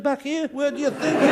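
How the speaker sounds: high, incredulous punchline. Retold or imitated. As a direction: squeak the punchline. Joke punchline character.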